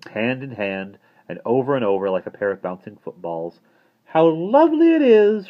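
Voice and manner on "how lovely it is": high, loud delighted lady; happy cry. A narrator's reading. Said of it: "cried Miss Tarlett happily".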